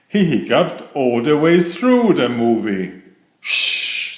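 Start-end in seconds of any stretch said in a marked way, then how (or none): 3.4-4.2 breathy hush from the cinema crowd; shushing. Acted. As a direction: whisper a shh. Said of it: "said everyone".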